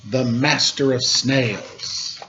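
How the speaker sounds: 1 grand title. Acted.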